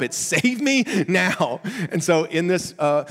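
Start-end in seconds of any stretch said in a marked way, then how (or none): 0.2-1.6 high, slow, drawn-out urgent plea. Shown as a demonstration. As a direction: stretch each word as a desperate demand. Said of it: "pushed in the deep end and you don't know how to swim".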